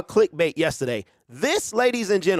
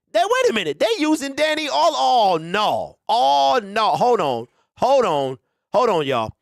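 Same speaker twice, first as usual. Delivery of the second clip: high, louder cry of disbelief; shocked disbelief; 5 semitones higher, 4 dB louder than usual. Real, not acted. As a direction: catch it mid-sentence, stunned. Sees rival video using Danny.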